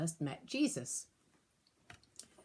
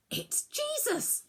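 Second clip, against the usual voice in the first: high, amazed cry of recognition; a sudden burst of joy; excited cry. A narrator's reading. Disciple spots Jesus on the shore, crying out high. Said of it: "suddenly cried out".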